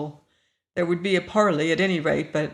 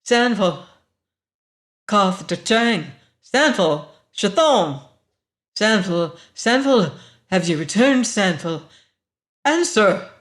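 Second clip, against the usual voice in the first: commanding woman; 2 semitones higher, 6 dB louder than usual. A narrator's reading. Unseen woman summons Sanfel through the screen, imperious. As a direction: summon like one used to being obeyed. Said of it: "voice of a woman used to wielding power".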